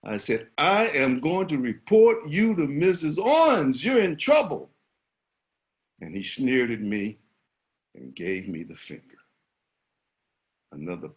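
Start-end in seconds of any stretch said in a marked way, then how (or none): 0.6-4.7 higher, louder threat to report him; yelling. Retold or imitated. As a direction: call out the punishment. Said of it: "And so I yelled at him".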